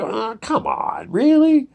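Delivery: high, drawn-out scoffing protest; mock disbelief. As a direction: pitch it way up, imitating an incredulous reaction. Mocking the simulation theory's claim.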